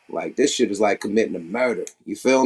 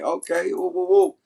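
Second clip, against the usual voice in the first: mock hype cheer; 7 semitones higher than usual. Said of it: "He pulls it up like".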